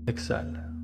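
guided meditation. Acted. Guide leading listeners through sacral chakra breathing, soft guided meditation. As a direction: guide the listener inward, softly and unhurriedly.

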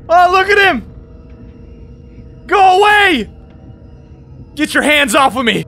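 high panicked screaming; panicked shout. Real, not acted. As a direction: yell in real panic. Fleeing monster, panicked.

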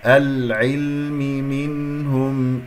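slow chanted العلم منهم, clear noon in منهم; drawn-out recitation of العلم منهم; tajweed demonstration. Shown as a demonstration. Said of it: "النون مع الهاء إظهار".

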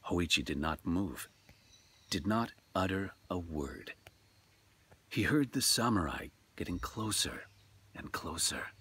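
measured, hushed storyteller read; story narration. A narrator's reading. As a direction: tell it like a ghost tale by lamplight.

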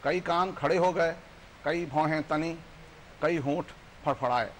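emphatic verse in short, separated phrases; poem recitation. Acted. Guest recites how society reacted, emphatic poetic recitation. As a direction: declaim each item, pausing after it.

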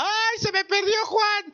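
mock wailing mother. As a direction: wail like a distraught mother, overdone. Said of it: "mi mamá lloraba así como Magdalena".

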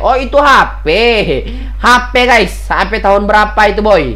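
high, loud teasing outburst; loud amused disbelief; amused exclaim. Real, not acted. Laughing at old phone.

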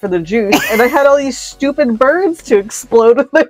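amused character voice. Acted. Megalodon offering friends some, read with bubbling laughter. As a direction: play the megalodon while stifling giggles. Voicing megalodon's line in story.

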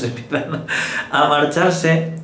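amused, laughing into the words; chuckle, then draw the words out slowly. Real, not acted. Priest amused by his own joke about heaven emptying, laughing.

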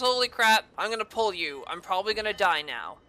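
usual speaking voice

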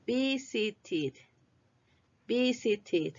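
"Visited" in separate clear syllables, ending "tid"; -ed as extra syllable. Shown as a demonstration. Teacher models past tense for repetition, syllabic -ed. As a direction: model "Visited" carefully, e like a short i. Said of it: "La E se pronuncia como I".

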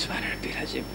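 barely voiced whisper of the prayer words; whispered tasbih. Shown as a demonstration. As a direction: breathe the words under your breath. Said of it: "ہم کہتے ہیں".

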